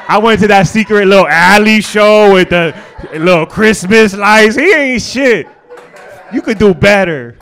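loud, high catty gossip; trash-talk the boyfriend dramatically; gossiping friends. Retold or imitated. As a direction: friends loudly dissing her man. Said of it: "they're gonna be like".

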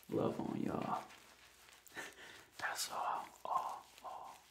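whispering. Real, not acted. ASMR talk fading to whisper.

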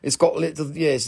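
ordinary delivery

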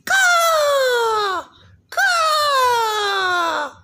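very high, loud squawking eagle voice; eagle puppet. Pretend. Father playing an eagle puppet for baby Calvin, high screechy squawk. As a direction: screech it out like a cawing eagle, drawing words out. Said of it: "I'm an eagle".

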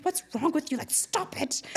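mom's scolding. Retold or imitated. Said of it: "And my mom's like".

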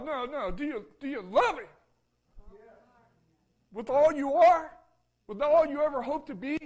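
loud, high-pitched preacher's appeal; fervent pulpit exhortation; preaching at full force. Acted. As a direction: drive the question home to the pews. Preacher presses congregation, loud.

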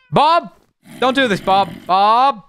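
high loud call after someone. Real, not acted. Gamer calling after Bob as he runs, loud high call. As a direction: shout the name after him.

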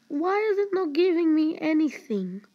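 high, loud wailing question; frustrated whine. Real, not acted. Player getting nothing from the game, loud frustrated whine. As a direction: whine it out in exasperation.

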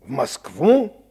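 loud, slow incredulous question; can't believe what he's asked; indignant surprise. Acted. Colonel balks at the doctor's request, loud incredulous.

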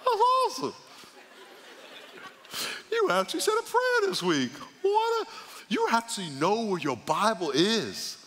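very high, gushing mock praise; squealing fake amazement, sky-high on "awesome"; mock-impressed God. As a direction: sarcastically marvel at a tiny achievement. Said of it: "God's not gonna say".

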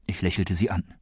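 plain reading voice

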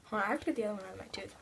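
quick whispered aside. Real, not acted. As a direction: rush it under breath. Picking bean from tooth.

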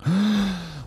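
gasp for air. Real, not acted. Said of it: "taken a breath".

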